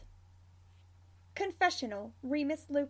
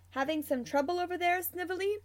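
higher, quick teasing question; feigned calm; 5 semitones higher, faster than usual. A narrator's reading. Sirius needles co-worker about his broken mouse, feigned calm. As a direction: ask it lightly, lifting at the end. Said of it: "Sirius asks calmly".

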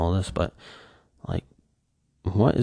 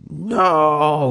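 mock scream; 7 semitones higher than usual. Fan reliving his reaction in the theater, mock scream. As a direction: wail it like a dismayed moviegoer. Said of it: "people that were screaming during that".

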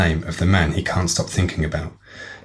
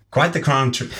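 higher-pitched southern belle voice; southern accent; 6 semitones higher, slower than usual. Acted. Alicia contradicts Nicole in a southern accent. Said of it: "she did have a southern accent".

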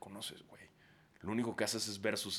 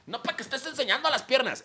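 high, loud mock macho scolding; mock a jealous husband; hammed-up jealous husband; 9 dB louder than usual. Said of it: "decirle a su esposa".

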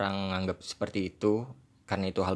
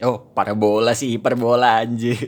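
loud exclamation; 2 semitones higher, 11 dB louder than usual. Real, not acted. Laughing at own word mix-up.

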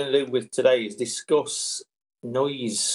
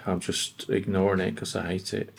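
lower, softer sulky dog voice; voicing the dog; 5 semitones lower, 6 dB quieter than usual. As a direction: grumble it as a sulking dog. Dog voiced in owner story.

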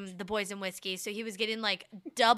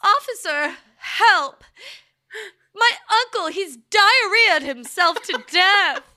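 high, loud panicked wailing; hammy distressed caller shouting for police; frantic cry for help; 10 semitones higher, 9 dB louder, slower than usual. Pretend. Mock frantic call to police.

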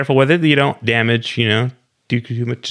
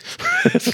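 shrieking giggle; sudden high laugh; high squeal laugh; 3 dB quieter than usual. Real, not acted. Laughing at own joke.

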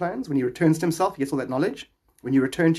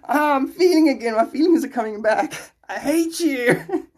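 acted patient; 11 semitones higher, 5 dB louder than usual. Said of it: "he's like".

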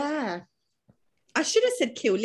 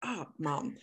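mock fed-up kids; 9 dB quieter, slower than usual. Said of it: "my kids are like".

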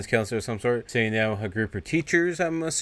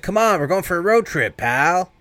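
higher taunting thug voice; mocking thug; 8 semitones higher, 3 dB louder than usual. Greaser mocking Chip while throwing him into the car, high and taunting. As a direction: sneer it out like a cocky thug.

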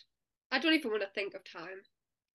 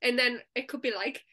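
normal talking voice

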